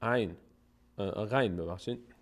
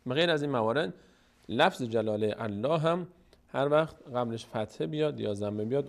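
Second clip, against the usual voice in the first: usual speaking voice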